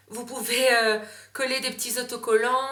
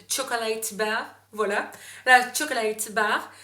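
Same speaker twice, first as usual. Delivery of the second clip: French-accented English; 3 dB louder than usual. Shown as a demonstration. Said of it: "excusez-moi pour mon anglais".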